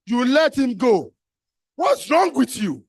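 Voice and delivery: slow, loud, exasperated; exasperated scolding. Real, not acted. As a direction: pourquoi tu l'as laissé partir, hein. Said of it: "scolding the audience".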